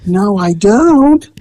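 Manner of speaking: high, slow timid answer; small cowed voice; lightly voiced scared woman; meek reply.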